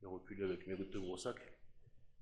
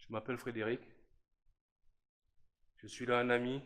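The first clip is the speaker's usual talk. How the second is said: louder, higher, announcing himself to the house; calling out; 4 semitones higher, 8 dB louder than usual. Real, not acted. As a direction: project it up the stairwell to whoever is there. Introducing himself to spirits aloud.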